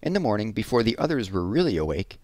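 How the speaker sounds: ordinary delivery, nothing marked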